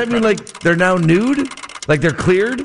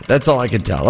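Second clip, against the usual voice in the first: ordinary talk, nothing marked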